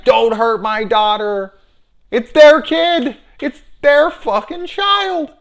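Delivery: high, loud, slow mock screech; mock shrieking wife. Wife screaming in retold scene.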